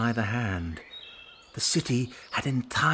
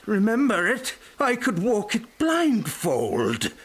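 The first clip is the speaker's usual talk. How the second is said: eager Scrooge; 10 semitones higher, 4 dB louder than usual. A narrator's reading. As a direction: insist you know it by heart. Scrooge eager about his old road.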